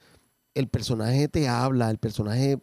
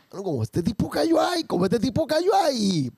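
amused realization; 10 semitones higher than usual. Retold or imitated. Retold realization, amused quote.

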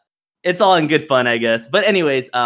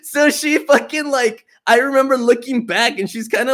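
usual speaking voice

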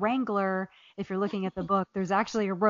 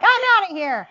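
high, loud "I'm outta here" flung out; mock exclamation; 11 semitones higher, 8 dB louder than usual. Retelling her breakup, she acts out bolting. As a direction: toss it off like storming out. Retold breakup exit, quoting herself.

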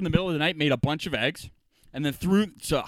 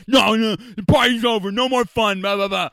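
mock scold; 6 semitones higher, 6 dB louder than usual.

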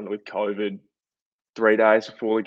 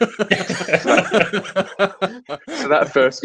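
laughing; 10 semitones higher, 4 dB louder, much slower than usual. Real, not acted.

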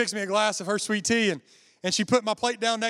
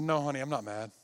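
gentle grandmother; 5 semitones lower, 7 dB quieter than usual. Retold or imitated. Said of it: "She said".

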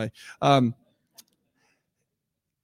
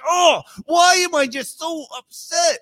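high, loud exasperated cry; frustrated outburst; 9 semitones higher, 6 dB louder than usual. Retold or imitated.

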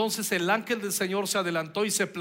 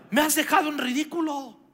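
higher furious shout; shouting Balaam; 8 semitones higher than usual. A narrator's reading. Balaam shouting in rage at his donkey. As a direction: yell it, humiliated and enraged. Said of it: "gritó Balaam".

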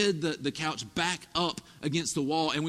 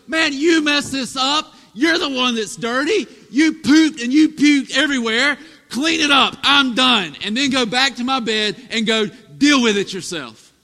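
put-on loud, higher scolding of the toddler; mock harsh dad; 6 semitones higher, 10 dB louder than usual. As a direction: bark it like a fed-up father. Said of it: "look at my four-year-old and go".

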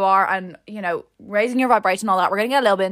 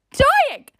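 high, loud squeal on "Dying"; mortified squeal; 5 dB louder than usual. Retold or imitated. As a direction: squeal "Dying" loudly in comic agony. Retold mortified reaction, repeated louder.

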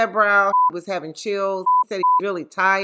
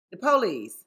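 ordinary talk

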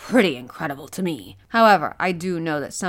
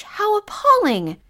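higher, louder and slow, shocked indignation; slow, high appalled exclamation; appalled outcry; 6 dB louder, slower than usual. Acted. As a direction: cry it out in disgust at the injustice. Aronnax outraged at divers' pay.

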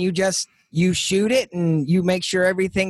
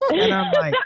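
laughing. Real, not acted. Laughs recalling the shock.

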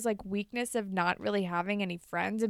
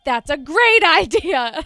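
louder, slower, laughing through "great"; laughing delight; 6 semitones higher, 11 dB louder, slower than usual. Retold or imitated. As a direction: gush with a giggle. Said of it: "Caitlin's like".

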